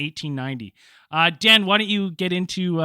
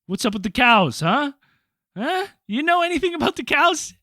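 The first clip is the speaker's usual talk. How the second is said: mock nosy stranger; 10 semitones higher, 4 dB louder than usual. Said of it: "been like".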